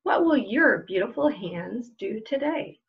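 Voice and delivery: slow, gentle picture-book read-aloud with long pauses; reading to children. Acted. Librarian reading a picture book to children at home, slow storytelling voice. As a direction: let each question hang for little listeners.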